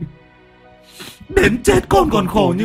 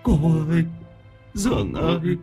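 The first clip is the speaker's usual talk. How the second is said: ghost weeping; 10 semitones higher than usual. A narrator's reading. Drowned boy's ghost crying to his aunt and uncle. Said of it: "Minh nức nở".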